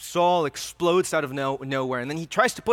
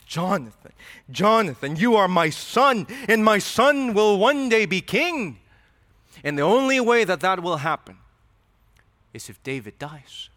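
coaxing Saul; 3 semitones higher, 4 dB louder than usual. Acted. Saul pleading with his son.

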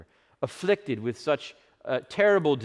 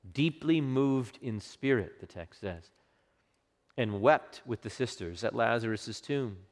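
ordinary talk, nothing marked

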